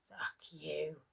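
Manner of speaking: the beaten wife whispers her defiance; battered whisper. Acted. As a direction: push the words out through a ruined mouth. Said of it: "her mouth too swollen and battered".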